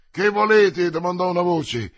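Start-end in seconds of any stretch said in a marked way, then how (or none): 0.1-0.9 raised, loud demand; shouted challenge. A narrator's reading. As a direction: call the question out to the riders. Enemy challenges the charging riders.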